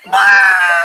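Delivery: put-on explosive high child scream; toddler wail. Mother imitating her toddler's exploding scream. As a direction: let the bomb go off in one shrill wail. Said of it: "and they go".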